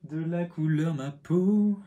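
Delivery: high, slow, silly voice repeating his own phrase; mocking own words. Shown as a demonstration. Parroting his own clumsy phrase to viewers, mocking. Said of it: "ça ne veut rien dire".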